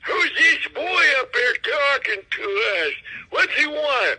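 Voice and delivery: a shrill little puppet heckling; squeaky ventriloquist dummy. Retold or imitated. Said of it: "translate my jokes from a puppet".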